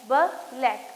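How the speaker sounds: "ब-लैक" split after the ब; teacher breaking "ब-लैक" into its sounds; blend sounds separated. Shown as a demonstration. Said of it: "अलग से सुनाई दे रहे हैं".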